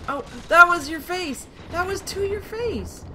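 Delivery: high, loud gloating shout; triumphant shout. Real, not acted. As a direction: crow over the headshot. Sniping enemy in the face.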